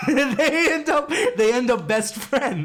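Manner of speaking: high, loud, slow words through laughter; laughing insistence. Real, not acted. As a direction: push it out while cracking up. Cracking up after Ted comparison.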